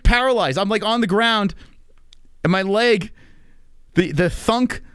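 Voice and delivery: ordinary delivery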